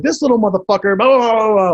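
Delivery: loud, high angry tirade; ranting yell. Retold or imitated. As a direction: rant at full volume. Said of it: "I'm, like, yelling".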